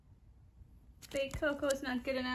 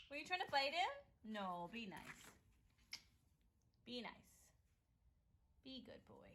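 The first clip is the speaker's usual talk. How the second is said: much softer gentle scold; quiet; 4 semitones lower, 15 dB quieter than usual. Real, not acted. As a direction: hush the pets down. Soft scolding to pet.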